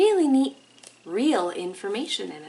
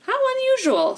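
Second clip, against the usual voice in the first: high, bright little bird voice; hummingbird voice; 5 dB louder than usual. Acted. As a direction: pitch it way up like a tiny bird. Hummingbird character speaks.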